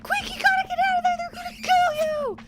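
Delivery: put-on high, loud panicked child's shout; little girl yelling in alarm as the eels attack; daughter panicking. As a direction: scream a frantic warning to mom. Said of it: "she's like".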